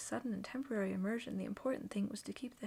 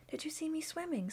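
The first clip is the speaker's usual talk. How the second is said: high, eager little voice; bursting to show off; higher squeaky child, excited; excited squeak; 8 semitones higher than usual. A narrator's reading. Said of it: "squeaked Roo excitedly".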